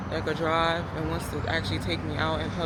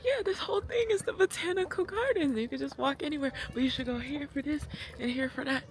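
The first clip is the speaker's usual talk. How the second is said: quoting the couple; 8 semitones higher than usual. Retold or imitated. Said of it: "they're like".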